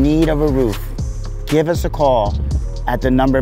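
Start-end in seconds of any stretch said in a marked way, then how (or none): none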